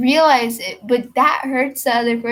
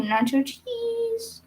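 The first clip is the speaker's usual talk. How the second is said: squeaky, slow, drawn-out punchline; high punchline; 11 semitones higher, 8 dB quieter, much slower than usual. Acted. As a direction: stretch the joke up high and slow.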